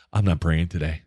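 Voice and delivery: imitated deep, understated declaration; low-voiced retelling. Chaplain telling his friend before the race, imitated low voice. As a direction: drop low for the chaplain's refusal. Chaplain's deadpan announcement retold.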